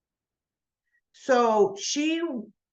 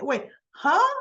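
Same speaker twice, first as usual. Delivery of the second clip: higher-pitched puzzled huh; mock baffled double take; retold puzzlement; 7 semitones higher, 3 dB louder than usual. Acting out a character's puzzled reaction in a soap recap, baffled.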